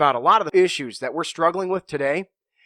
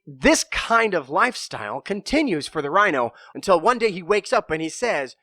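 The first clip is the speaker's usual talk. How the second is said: ordinary delivery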